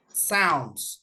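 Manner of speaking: ordinary talk, nothing marked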